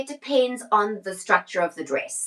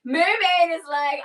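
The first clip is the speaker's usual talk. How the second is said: giggling through the words, pitch up; laughing; 7 semitones higher than usual. Real, not acted. Seller giggles to viewer Carol about the mermaid fabric, laughing. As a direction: laugh as you start the sentence.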